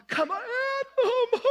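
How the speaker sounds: high whiny "come on, Mom"; hammed-up childish whine; whiny kid voice.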